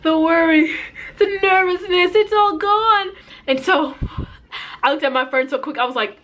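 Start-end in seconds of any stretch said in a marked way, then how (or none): 0.0-3.1 high, rising burst of joy; overjoyed relief. Retold or imitated. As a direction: let it climb higher with each word of relief. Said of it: "I was so freaking happy".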